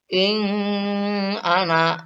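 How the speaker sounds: slow "In ana" with the nun blurred and faint; blurred wrong reading. Shown as a demonstration. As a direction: let the nun fade unclear, as a mistake. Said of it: "dibaca dengan suara samar". (bacaan samar yang salah pada "In ana")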